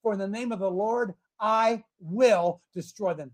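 ordinary talk

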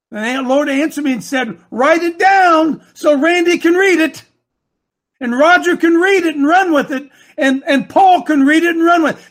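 loud, high, rallying sermon shout; full-force preaching. Acted. Lord tells coach to write the vision down, preached at full force. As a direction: drive each phrase home like a charge to the congregation.

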